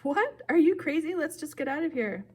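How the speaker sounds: high, alarmed disbelief; panicky, high-pitched objection; incredulous. A narrator's reading. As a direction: blurt it out in shocked protest. Boy balks at killing Medusa.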